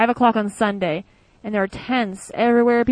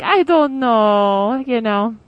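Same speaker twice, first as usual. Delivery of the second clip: put-on slow, shrugging Italian accent; lightly imitated Italian accent, very slow; 4 dB louder, much slower than usual. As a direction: drag it out like a carefree Italian friend. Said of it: "in German with an Italian accent".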